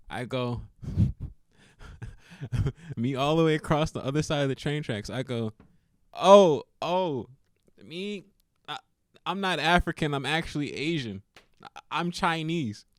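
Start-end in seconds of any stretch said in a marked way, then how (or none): 6.1-12.8 higher, louder, bright innocent claim; mock-innocent confusion. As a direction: play dumb and sweet to throw her off. Playing dumb to confuse her.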